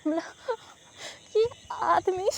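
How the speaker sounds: laughing in disbelief; exasperated laugh. Real, not acted. Student laughing in disbelief at the sir's police excuse. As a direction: laugh it out, fed up.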